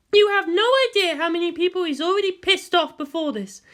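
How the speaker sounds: high, loud voiced women arguing; female voices argue. Guardian and girl argue over the commander, heated female voices. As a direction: play both women hot-tempered.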